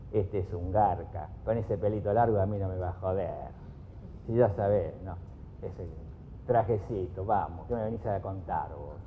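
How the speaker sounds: dismissive inner monologue judging a stranger; prejudiced inner voice. Retold or imitated. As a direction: mutter the prejudice under your breath. Voicing the listener's prejudiced inner thoughts.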